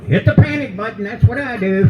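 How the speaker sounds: high mock old-lady call; old lady yelling. Old lady shouting advice at the lost comic, high mock call. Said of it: "some old lady yelled".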